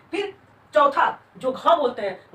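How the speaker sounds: ordinary talk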